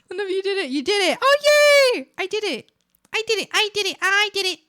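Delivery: high, loud gleeful celebrating; delighted victory chant; excited. Real, not acted. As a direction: cheer I did it over and over. Celebrating getting it right.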